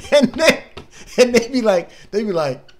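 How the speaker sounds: loud, high, laughing build; excited. Real, not acted. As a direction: rush it out, laughing.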